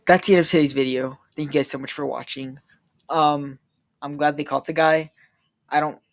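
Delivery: usual speaking voice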